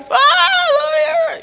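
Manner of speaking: sudden piercing high scream; retold scream. Relative throws herself on mother, screaming. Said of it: "Ella se le tiró encima a gritar".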